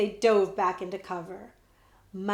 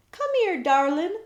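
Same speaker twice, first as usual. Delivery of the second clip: coaxing; 8 semitones higher, 5 dB louder than usual. Acted. Coaxing a lost bear.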